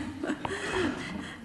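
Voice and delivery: bright burst of laughter; a quick real laugh at the memory; short high laugh. Real, not acted. Laughs after a funny fact.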